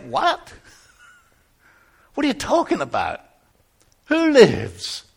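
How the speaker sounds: high-pitched, startled questioning; baffled, voice jumping up; incredulous. Acted. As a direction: demand an answer in disbelief. Shepherd baffled by the shouted news.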